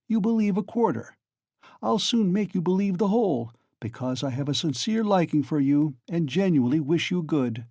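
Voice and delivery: lightly voiced sly magistrate; Porphyry, lightly voiced. A narrator's reading.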